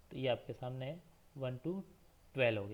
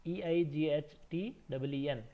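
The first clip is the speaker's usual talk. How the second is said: slow careful spelling; slower than usual. Shown as a demonstration. Teacher warning kids about the spelling, slow and deliberate. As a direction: spell it out slowly for the class. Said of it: "आप भी ध्यान रखिएगा इसमें".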